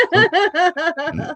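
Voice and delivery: high, slow, laughing plea. Retold or imitated. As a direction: squeal it through laughter. Laughing while quoting himself.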